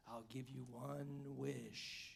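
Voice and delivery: slow, spaced-out mock genie pronouncing the wish. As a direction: lightly play a grand genie granting a wish. Genie speaks in a joke.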